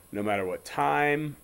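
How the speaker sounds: ordinary talk, nothing marked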